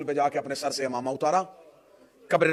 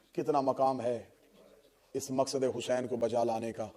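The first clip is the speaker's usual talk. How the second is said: hushed prayer; 4 semitones lower than usual. Real, not acted. Soft prayer and solemn lament.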